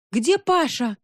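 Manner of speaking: narrated cry. A narrator's reading. Girl calling for Pasha in the smoke, high anxious cry. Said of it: "кричала Любочка".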